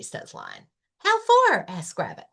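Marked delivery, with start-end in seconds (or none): 1.0-1.6 very high, loud eager bunny voice. Acted. Rabbit offering a picnic to Lion, very high and loud. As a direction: squeal it out happily.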